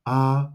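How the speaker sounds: A held high and level; sing-like steady high pitch on A, no movement; first tone. Shown as a demonstration. Teacher modelling tones for repetition, A high and flat. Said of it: "which is high and flat".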